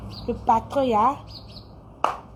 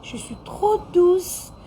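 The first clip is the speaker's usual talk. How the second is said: put-on slow, sweet babyish voice; 6 semitones higher, slower than usual. Shown as a demonstration. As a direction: coo it like a baby. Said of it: "Je parle comme un bébé".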